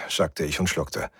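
usual speaking voice

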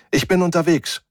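high, brisk voice reporting in; woman over comm. A narrator's reading. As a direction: answer promptly over the comm. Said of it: "drang ihre Stimme aus dem Komm". (hohe Stimme aus dem Komm)

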